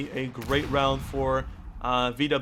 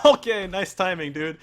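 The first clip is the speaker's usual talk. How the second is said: excited; 5 semitones higher, 7 dB louder than usual. Real, not acted. Excited reaction to clutch play.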